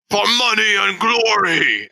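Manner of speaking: high, drawn-out charging yell; yelled battle cry. Acted. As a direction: cry it out as you swing into the fight. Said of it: "and s-, yell".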